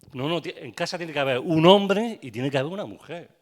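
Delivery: loud, insistent sermon voice; forceful preaching. Acted. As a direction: hammer the point home to the church. Preaching loudly on men. (predicación enérgica y en voz alta)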